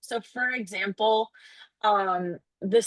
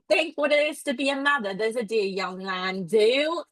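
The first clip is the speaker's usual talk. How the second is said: put-on terrible British accent. Nurse urging the mother to think, in a mock British working-class accent. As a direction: put on a playful, badly faked British accent. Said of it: "takes me a minute to get into it".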